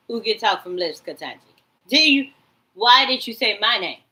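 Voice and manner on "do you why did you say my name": mock loud question. Mimicking the voices questioning.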